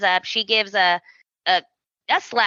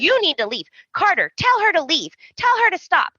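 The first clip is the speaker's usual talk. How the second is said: rising pleading; 8 semitones higher than usual. Retold or imitated.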